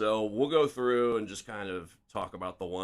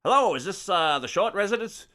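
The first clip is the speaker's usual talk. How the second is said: higher, louder forties Mid-Atlantic reporter voice; Mid-Atlantic accent; 9 semitones higher, 6 dB louder than usual. Acted. Reporter phoning the victim's mother, higher Mid-Atlantic accent. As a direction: put on an old Mid-Atlantic newsman's phone voice. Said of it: "a pretty good Mid-Atlantic accent".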